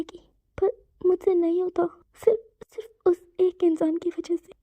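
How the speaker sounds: high, breaking voice close to sobs; a son crying out his despair; tearful breakdown. Acted. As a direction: pour it out while crying. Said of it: "आंखों से गिरते हुए आंसुओं को पोंछता".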